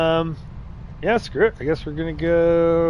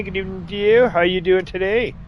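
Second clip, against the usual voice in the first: usual speaking voice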